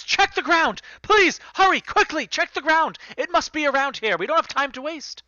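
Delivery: high, loud hurried commands; urgent orders. Acted. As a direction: push them to search fast. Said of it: "And he says".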